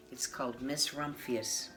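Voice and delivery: plain reading voice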